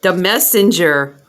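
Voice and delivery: card name called out loud; loud announcement. Real, not acted. Reader announcing her drawn tarot card, loudly. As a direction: announce the card with a burst of delight.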